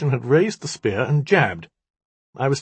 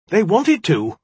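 high, louder heated retort; angry cry; 9 semitones higher, 6 dB louder, slower than usual. A narrator's reading. Magician answers the djinni's taunt, crying out. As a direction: fire it back hotly, pitched up. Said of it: "he cried".

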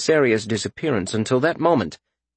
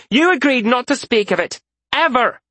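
angry reproach; 12 semitones higher than usual. A narrator's reading. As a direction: hammer home the broken promise. Isaria continues argument.

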